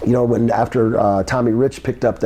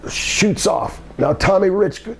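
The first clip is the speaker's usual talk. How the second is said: normal talking voice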